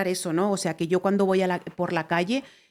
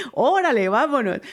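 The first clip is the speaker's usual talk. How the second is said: louder, laughing órale, vámonos; órale and vámonos said with a laughing, louder, playful lilt; excited playful repeat; 5 semitones higher, 4 dB louder than usual. Shown as a demonstration. Repeating the invitation to the admirer, playful and excited. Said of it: "repeated after laugh".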